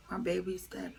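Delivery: broken whisper; whispering through grief. Retold or imitated. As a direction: barely get it out, devastated. Telling mom baby died.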